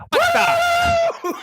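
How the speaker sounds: high startled shout; shocked yelp. Acted. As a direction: squawk in disbelief. Machine operator shocked.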